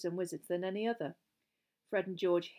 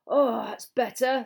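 loud, slow words through food; mouth full; 4 semitones higher, 9 dB louder, slower than usual. A narrator's reading. Hungry boy eating at the feast, mouth full. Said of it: "with his mouth full of mashed potato".

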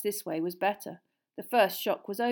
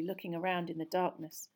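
ordinary delivery

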